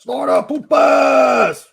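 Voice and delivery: long, loud, drawn-out call of the name; big drawn-out welcome shout; shouted greeting. Real, not acted. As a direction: belt the name out like a cheer. Greeting a chat member by shouting.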